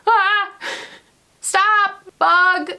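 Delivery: high panicked shrieks; screaming at a bug. Real, not acted. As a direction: shriek in real fright. Bug flying at her.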